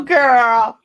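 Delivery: loud excited scream; screaming at her friend. Retold or imitated. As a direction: shout it out in delight. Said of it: "Then I start screaming".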